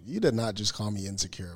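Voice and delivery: usual speaking voice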